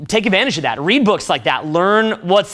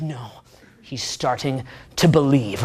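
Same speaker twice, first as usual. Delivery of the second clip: lower, softer, knowing mentor; a hint of a hushed wise mentor; Lawrence Fishburne calm; 6 semitones lower, 5 dB quieter than usual. Mentor telling the others Neo believes, lightly voiced low. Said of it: "He looks at him, he's like".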